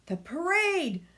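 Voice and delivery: put-on rising alarmed boy's yell; frantic child yelling. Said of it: "yelled Billy".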